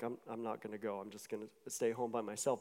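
low, soft grumbling to oneself; retold sulky refusal, kept low and under the voice; reluctant refusal. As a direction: dig in quietly, as if talking to yourself. Acting out own reluctant refusal.

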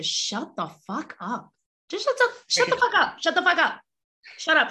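high, fast, heated snap; exasperated rant at haters; angry shut up. Real, not acted. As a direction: tell them off in a burst. Heated dismissal of critics.

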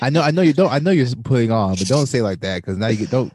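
loud, laughing objection; loud laughing protest. Real, not acted. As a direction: protest loudly while laughing. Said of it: "all right, all right".

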